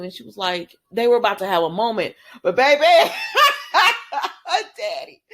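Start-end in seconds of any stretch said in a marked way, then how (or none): 2.4-5.2 laughing mid-sentence; breaking into high laughter. Real, not acted. Laughing while retelling scene.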